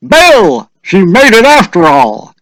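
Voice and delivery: high, loud called teasing; calling out. A narrator's reading. Villager calls to the husband, high and loud. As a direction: shout it across the crowd to Bill.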